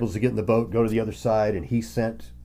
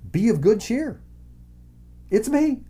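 reassuring call; 11 semitones higher than usual. Acted. Jesus reassures frightened disciples.

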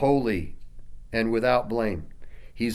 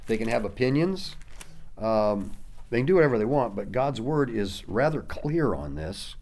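ordinary talk

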